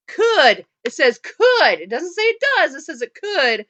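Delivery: "could" punched loud and falling; stress on could. Real, not acted. Host correcting that it doesn't say does, loud stress on "could". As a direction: hit every "could" hard and loud.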